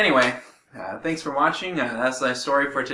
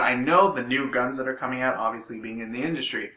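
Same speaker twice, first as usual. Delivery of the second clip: ordinary talk, nothing marked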